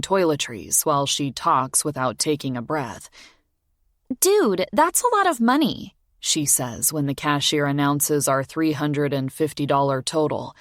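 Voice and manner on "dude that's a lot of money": put-on much higher, carefree girl's voice; young girl. A narrator's reading. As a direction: toss it off like a teenager. Morgan voiced high.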